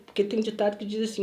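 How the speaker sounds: ordinary talk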